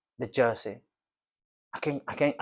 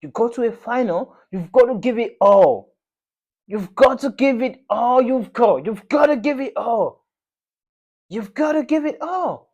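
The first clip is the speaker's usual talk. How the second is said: loud and much higher, hammering each word; real shouted rant; 9 semitones higher, 8 dB louder than usual. Real, not acted. Fan yelling at his team after a lost final, full shout. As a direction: bellow it like a gutted fan demanding effort.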